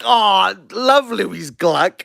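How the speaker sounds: high, slow, drawn-out fake gushing; mock adoration. Everyone claiming they know the winner, mock gushing. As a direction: gush like someone pretending to be a fan. Mocks people pretending to know her.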